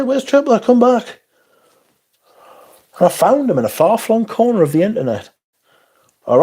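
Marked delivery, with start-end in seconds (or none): 0.0-1.2 mock high calling. Mock quoting own earlier calls.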